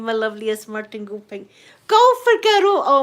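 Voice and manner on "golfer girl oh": excited greeting. Real, not acted. Greeting a viewer happily.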